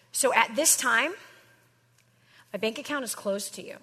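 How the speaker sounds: usual speaking voice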